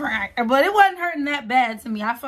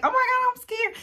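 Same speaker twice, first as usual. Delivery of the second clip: high, panicky imitation of a frightened friend; mock scared friend; 14 semitones higher than usual. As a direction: squeak it out like a scared girl. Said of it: "she was just like".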